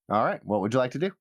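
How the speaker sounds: ordinary talk, nothing marked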